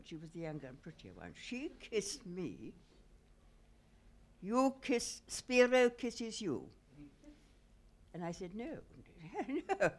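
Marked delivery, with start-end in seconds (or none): 1.4-6.7 slow, drawn-out broken-English Greek fisherman bargaining. Retold or imitated. Fisherman pressing the women for kisses: slow Greek broken English. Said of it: "That was my cousin". 8.6-9.9 no, no through laughter; laughing refusal. Real, not acted. Laughing off the fisherman.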